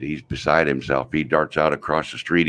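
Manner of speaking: ordinary delivery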